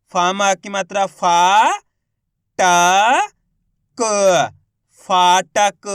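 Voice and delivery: long फा, ट, क, then फाटक loud; spelling into a stretched फाटक; letter by letter. Shown as a demonstration. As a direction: sound out each letter, then blend. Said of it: "एक अक्षर का उच्चारण करके".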